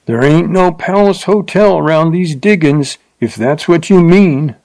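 sneering at the fine lady; leering. A narrator's reading. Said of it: "the man leered at her".

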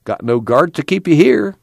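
impatient, high-pitched challenge. A narrator's reading. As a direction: push him to get going, pitch jumping up. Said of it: "Tom said impatiently".

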